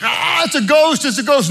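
high, mock-frightened cry of ghost; high hammed-up panic. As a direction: ham up the disciples' scared shriek. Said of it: "Disciples are freaking out".

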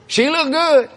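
high emphatic praise; admiring exclaim. Real, not acted. Grandson to congregation, proudly high and emphatic. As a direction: say it with proud wonder.

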